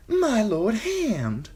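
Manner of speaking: high, slow, drawn-out singer's greeting; bow and gush the title; eager fawning singer. A narrator's reading. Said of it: "He knelt".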